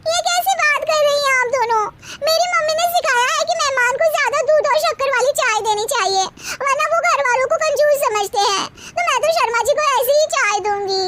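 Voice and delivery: youngest daughter-in-law. Acted. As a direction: lecture your sisters-in-law, pitched high. Youngest bahu, high voice.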